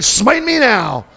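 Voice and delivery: high, loud mock-desperate demand; hammed-up fed-up outburst; smart-aleck Moses. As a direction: dare God with theatrical exasperation. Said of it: "He's just being a real smart aleck".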